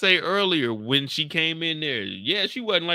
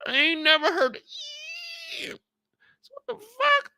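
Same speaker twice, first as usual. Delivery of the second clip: startled disbelief. Retold or imitated. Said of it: "I said".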